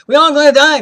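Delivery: loud, high-pitched cry of protest; retold outburst. Nurse telling patient everybody dies, loud outburst. Retold argument about dying.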